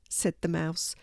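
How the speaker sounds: ordinary delivery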